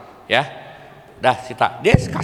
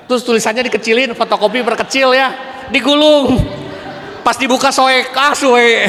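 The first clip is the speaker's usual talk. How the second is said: excited; 8 semitones higher, 5 dB louder than usual. Real, not acted. Excited retelling of cheating tricks.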